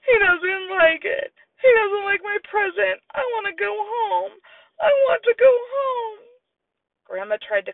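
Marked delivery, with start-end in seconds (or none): 0.0-3.0 high, tearful, slow sobbing words; a little boy crying over his gift; crying child. Acted. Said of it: "Sam cried". 3.1-4.4 put-on a toddler whimpering to leave; tearful whimper. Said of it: "Sam cried". 4.8-6.2 high, louder wailing plea; a toddler bawling to go home; crying wail. Acted. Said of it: "Sam cried".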